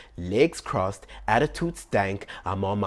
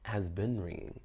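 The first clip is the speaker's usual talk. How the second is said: sweet hushed plea; 4 semitones lower, 9 dB quieter than usual. Retold or imitated. Sweetly asking boyfriend on phone.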